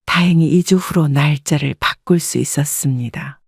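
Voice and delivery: ordinary delivery, nothing marked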